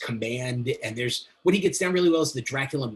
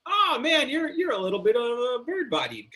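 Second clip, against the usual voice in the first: mock viewer reaction; 11 semitones higher than usual. Viewer reacting to a shirtless film Dracula, high mock voice. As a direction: start in a high-pitched mock groan. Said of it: "You're kind of just like".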